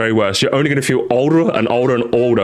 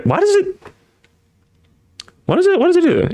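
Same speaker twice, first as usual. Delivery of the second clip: much higher, baffled question. Real, not acted. Streamer baffled by the red join button, high surprised squeak. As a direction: squeak in disbelief.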